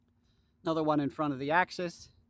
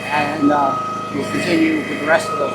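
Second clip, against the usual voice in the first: shouting over machinery; 2 semitones higher, 15 dB louder than usual. Real, not acted. Talking over the running log splitter.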